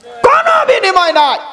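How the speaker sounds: high, ringing cry falling at the end; impassioned shout. Real, not acted. Preacher declares Allah asks nothing in return, impassioned shout. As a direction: shout the answer high over the crowd. Preacher cries answer to crowd.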